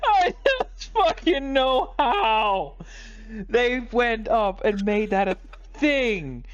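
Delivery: high, drawn-out wail of disbelief; despairing high-pitched cry; anguished outburst. Real, not acted. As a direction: wail it slowly, high-pitched, utterly exasperated. Anguished rant over the movie.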